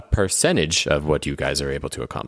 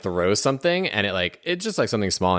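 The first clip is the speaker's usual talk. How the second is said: usual speaking voice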